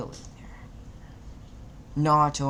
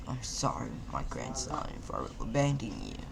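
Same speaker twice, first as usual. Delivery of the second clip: soft, near whispered apology; hushed apology; 5 semitones lower, 7 dB quieter than usual. A narrator's reading. Grandfather apologizing to Naruto, quiet and fading. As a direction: let it fall to a breath.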